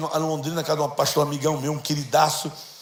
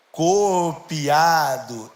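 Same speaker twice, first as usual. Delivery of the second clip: stretched slowly, each syllable weighed; slow, emphatic repeat; 3 semitones lower, 5 dB louder, much slower than usual. Acted. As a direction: drag out copiado as a firm order. Repete a ordem bem devagar. ("Copiado" bem lento e enfático, sílaba por sílaba)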